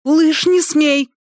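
high, drawn-out plea to stop; alarmed protest. A narrator's reading. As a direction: sister trying to stop her brother, voice shooting up. Sister lunges to stop brother.

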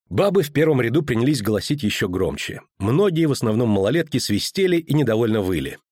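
ordinary delivery

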